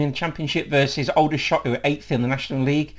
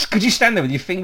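ordinary talk, nothing marked

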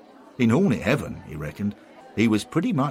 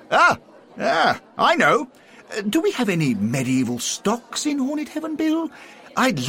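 put-on high, excited old gentleman voice; lightly voiced Henry, giddy with an idea; eager old gent; 5 dB louder than usual.

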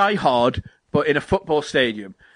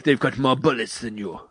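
lower-pitched imitation Russian accent; mock Russian accent; 5 semitones lower than usual. Retelling the trailer to co-host, low mock Russian accent. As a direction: lightly put on a deep Russian accent. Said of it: "like, a Russian accent".

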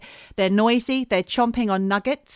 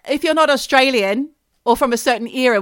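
normal talking voice

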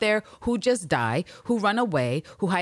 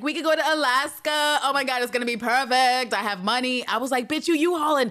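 high excited teasing; mock giddy Villanelle; 8 semitones higher than usual. Speaker mocking Villanelle's Alaska plan, high and teasing. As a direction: gush then scoff in a higher voice.

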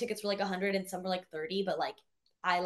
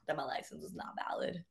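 whispering it under her breath; hushed whisper; 6 semitones lower, 12 dB quieter than usual. Retold or imitated. Confessing the worry.

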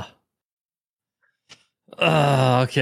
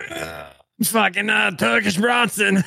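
slow, higher, strained push of Turkish Bronson; straining on the toilet; 7 semitones higher, slower than usual. Pretend. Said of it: "act like I'm on the toilet going".

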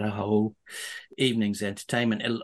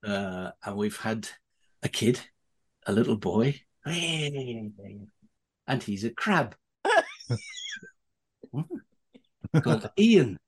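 retold Vic Reeves bit; slower than usual. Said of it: "Vic goes up, said".

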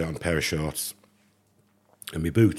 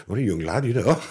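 laughing; 4 semitones higher, 4 dB louder than usual. Real, not acted. Laughing at the memory.

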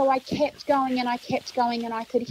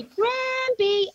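calling out; 12 semitones higher than usual. Retold or imitated. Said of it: "I'm gonna say".

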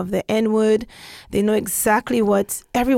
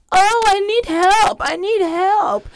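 slow, high and louder mock whine; sarcastic mocking; 11 semitones higher, 4 dB louder, slower than usual. The patronizing woman mocking their offer of help, sarcastic whine. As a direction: drip sarcasm like a put-upon Karen. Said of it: "very sarcastic".

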